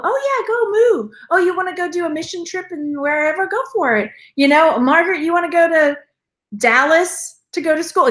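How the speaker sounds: sing-song mom voice. Retold or imitated. Mom's reply to brother retold.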